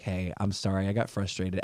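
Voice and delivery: soft, low apology to a daughter; a dad quietly saying sorry to his girl; tender apology. Retold or imitated. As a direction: gently own your mistake.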